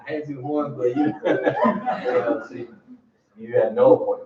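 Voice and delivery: can't finish the line without cracking up; retelling the agent's excuse, laughing through it. Real, not acted.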